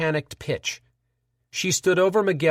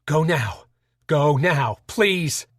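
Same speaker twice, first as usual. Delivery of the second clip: desperate plea; slower than usual. Acted. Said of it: "desperation in Carl's voice".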